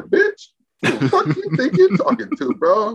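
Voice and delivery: retold retort pitched very high; angry comeback. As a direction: fire it back like you won't take that.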